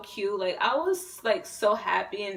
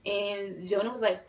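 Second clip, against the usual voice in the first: normal talking voice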